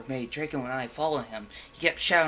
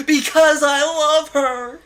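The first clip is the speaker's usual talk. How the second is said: high, loud, slow shriek; 13 semitones higher, 13 dB louder, slower than usual. A narrator's reading. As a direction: shriek the confession. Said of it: "Draco shrieked".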